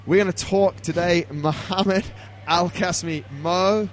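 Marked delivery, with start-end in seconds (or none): none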